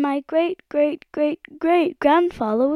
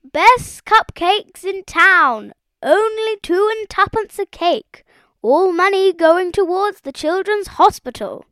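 high, loud street-seller cry; top-of-voice shout; 11 semitones higher, 6 dB louder than usual. A narrator's reading. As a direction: hawk the cakes to the whole street. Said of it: "shouted at the top of his voice".

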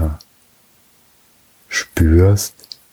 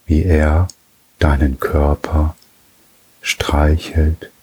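ordinary delivery

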